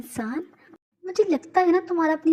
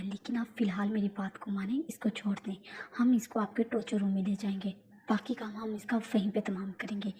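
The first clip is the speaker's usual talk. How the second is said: urgent whispered advice; whispering; 4 semitones lower, 7 dB quieter than usual. Acted. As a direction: keep it secret, whisper low. Suga continues in hushed tone.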